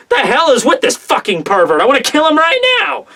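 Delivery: mock outrage climbing high at the end; furious inner self. Bride's inner self wanting the pervert dead, mock fury. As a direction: imitate seething disgust, lightly hammed up. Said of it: "her inner self".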